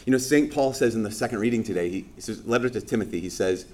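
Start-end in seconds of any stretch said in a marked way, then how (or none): none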